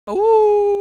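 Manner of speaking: long, loud, high howl; wolf howl. Acted. Wolves looking at the Moon, drawn-out howling. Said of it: "The wolves go".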